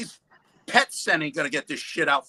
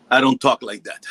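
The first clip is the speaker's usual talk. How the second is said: ordinary delivery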